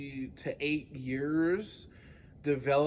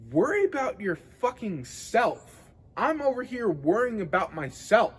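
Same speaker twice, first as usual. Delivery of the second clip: higher-pitched, exasperated retort; pissed off; 5 semitones higher, 4 dB louder than usual. Retold or imitated. Gym-goer telling off an unwanted adviser, pissed off. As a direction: vent it like a comeback you never said. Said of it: "it pissed me off so much".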